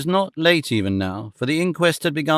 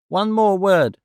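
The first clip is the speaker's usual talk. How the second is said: put-on a lightly quavering old man, a touch higher; old witness asks the court for one more word, quavering; 6 semitones higher, slower than usual. A narrator's reading. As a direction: let the old voice tremble slightly. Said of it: "he said in a quavering voice".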